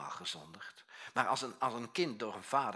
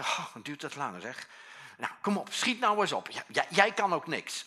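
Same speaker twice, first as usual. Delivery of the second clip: much higher, louder nagging snap; impatient mother; 5 semitones higher, 9 dB louder than usual. Retold or imitated. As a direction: hurry the child, fed up. Mother nags her slow child.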